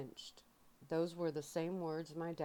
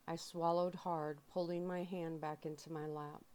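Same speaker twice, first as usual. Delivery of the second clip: ordinary delivery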